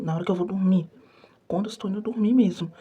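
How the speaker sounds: normal talking voice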